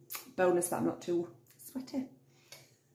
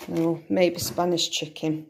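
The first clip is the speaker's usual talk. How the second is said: normal talking voice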